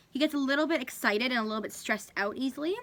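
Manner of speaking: ordinary talk, nothing marked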